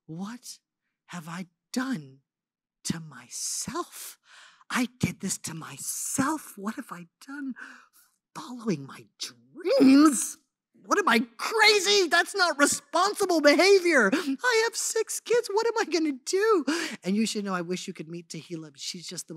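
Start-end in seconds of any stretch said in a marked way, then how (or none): 0.1-7.5 slow, soft tearful questioning; tearful lament. Retold or imitated. Woman crying herself to sleep at night, soft tearful murmur. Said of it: "I was just crying myself to sleep". 8.3-16.6 panicked self-reproach. Retold or imitated. Mother of six scolding herself about her dreams, frantic high panic.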